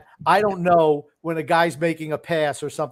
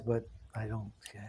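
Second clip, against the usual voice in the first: whisper; 4 semitones lower, 16 dB quieter than usual. Real, not acted. Aside under his breath.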